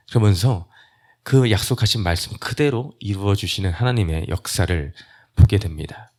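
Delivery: usual speaking voice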